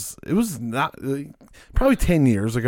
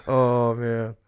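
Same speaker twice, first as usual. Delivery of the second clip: usual speaking voice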